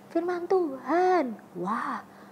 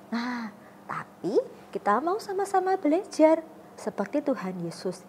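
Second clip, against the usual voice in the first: ordinary talk, nothing marked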